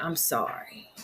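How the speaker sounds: hushed son's voice saying sorry; son's soft apology. Retold or imitated. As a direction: hear it faint as a son's voice. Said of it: "he was like".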